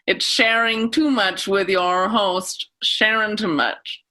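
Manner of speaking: slow radio host introducing the show; Sharon Too Much. Acted. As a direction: draw out the show title like a public radio host. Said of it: "like the public radio host".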